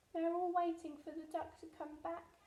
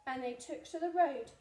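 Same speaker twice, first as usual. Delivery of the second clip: plain reading voice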